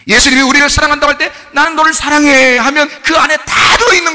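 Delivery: very loud, high impassioned preaching; full-force preaching. Acted. Preacher proclaiming Jesus' love to the congregation, loud and high. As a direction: cry it out to the whole church.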